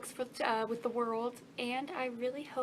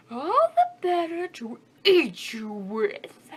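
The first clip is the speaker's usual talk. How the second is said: menacing wolf; 4 semitones higher, 10 dB louder, much slower than usual. Acted. Wolf's menacing climax.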